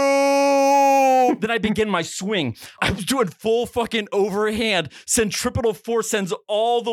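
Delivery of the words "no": long, drawn-out high yell; slow-motion scream. Retold or imitated. Little kid sees the chair coming, mock slow-motion yell. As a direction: stretch it out like a movie slow-motion cry. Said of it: "and then time slows down".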